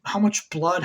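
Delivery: usual speaking voice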